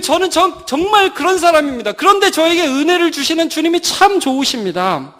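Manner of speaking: high-pitched, overwhelmed prayer of thanks; hammed-up weeping gratitude; imitated tearful wailing. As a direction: cry out to the Lord, acting overcome. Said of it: "막 통곡을 했을 거예요".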